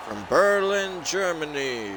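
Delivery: high, slow, drawn-out boxing intro; ring announcer. Acted. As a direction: stretch every word like a boxing announcer.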